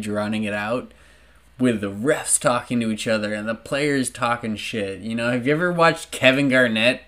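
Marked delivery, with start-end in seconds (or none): none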